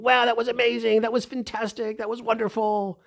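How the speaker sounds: very high, excited mock gushing; gushing crowd. Audience members congratulating a young fan, imitated high gushing. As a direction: imitate admirers piling on praise in a high voice. Voices the admiring audience around him.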